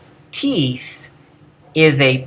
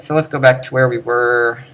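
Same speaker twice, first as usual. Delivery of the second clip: ordinary talk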